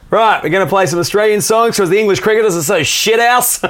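put-on loud, high-pitched drunken heckler; obnoxious drunk. Drunk mate heckling English cricket fans at a gig, obnoxious and loud. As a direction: bellow it like a boorish drunk mate. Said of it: "He's a really obnoxious drunk".

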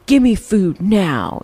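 lightly voiced bossy child, slow; mild mock demand; a hint of a demanding kid. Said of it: "We don't have to say".

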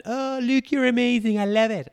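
mock a fan showering him with praise; gushing fan. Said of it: "rather than".

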